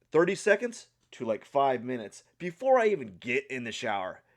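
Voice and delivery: ordinary talk, nothing marked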